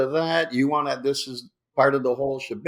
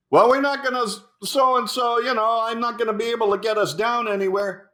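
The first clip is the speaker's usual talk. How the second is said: high, raised voice over rotor noise; pilot shouting over chopper; 9 semitones higher than usual. Retold or imitated. Pilot to Hasselhoff in the chopper, shouting high. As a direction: call out over the engine. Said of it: "Why are you talking so loud?".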